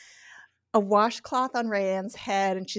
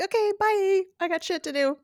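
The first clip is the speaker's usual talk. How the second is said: high, sing-out farewell; airy, pitched-up kiss-off; breezy mock goodbye; 10 semitones higher than usual. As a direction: imitate someone carelessly heading off. Mocking the mother's breezy exit.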